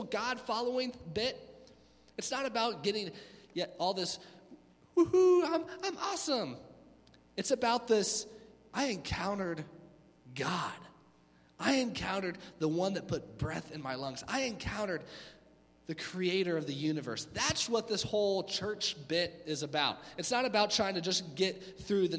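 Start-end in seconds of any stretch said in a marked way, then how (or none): 5.0-6.6 mock self-hype. 8.7-17.3 reverent testimony. Acted. Reverent testimony of meeting God.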